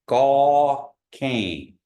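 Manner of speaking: Gaw Kang loud, Kang nasal and falling. Shown as a demonstration. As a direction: finish Gaw Kang in the nose. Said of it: "So you're saying".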